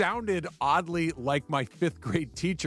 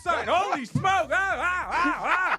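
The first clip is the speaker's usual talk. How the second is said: high shouting mock panic in the car; 12 semitones higher, slower than usual. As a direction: yelp like a terrified passenger. Mock panic riding with Funch.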